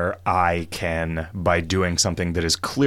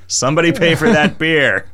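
shout; 8 semitones higher, 6 dB louder than usual. Retold or imitated. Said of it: "by shouting".

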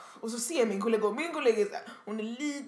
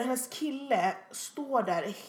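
ordinary delivery

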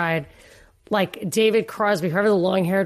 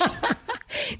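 short airy laugh; a quick unvoiced chuckle; breathy laugh; 7 dB quieter than usual. Real, not acted. Laughing at cannabis joke.

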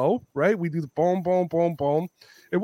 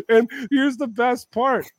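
laughing; 9 semitones higher, 5 dB louder than usual. Real, not acted. Laughing setup of ironic twist.

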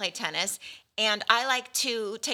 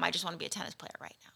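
quick breathy whisper; 4 semitones lower, 10 dB quieter, much faster than usual. Retold or imitated. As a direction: rush it out in a whisper. Imagined daughter voice.

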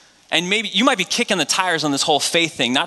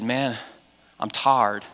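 low, slow Deep South tired; Southern drawl; 6 semitones lower, 5 dB quieter, much slower than usual. Retold or imitated. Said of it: "tarred and feathered kind of tarred".

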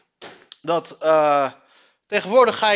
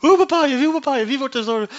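high, breathless laughing question; hilarisch ongeloof; 7 semitones higher, 3 dB louder than usual. Real, not acted. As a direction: ask it while losing it. Incredulous question amid laughing fit. (hoog en ongelovig lachend)

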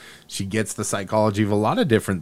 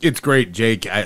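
ordinary delivery

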